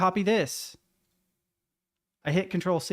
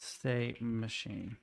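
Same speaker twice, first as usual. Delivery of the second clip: slow dictation; 5 semitones lower, 10 dB quieter, much slower than usual. Real, not acted. Dictating while typing.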